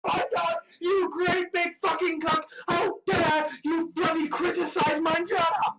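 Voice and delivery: high-pitched shouted outrage; furious tirade. Acted. Worker yells at someone criticizing their job, furious.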